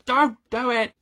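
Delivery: high, loud urgent cry; mock warning shout. Reader shouts at a book character, mock warning. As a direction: yell it as a hammed-up warning. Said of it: "Or shout at Wallace".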